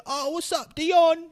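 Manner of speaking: mocking people who greet him in a fake accent; mock bad Kiwi accent. People greeting Dion in a high, hammed-up fake Kiwi accent. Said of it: "They try to sound like me".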